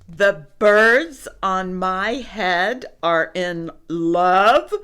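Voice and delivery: slow, loud, building question; incredulous elephant, loud rising finish; appalled disbelief. A narrator's reading. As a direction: draw it out, swelling on the last word.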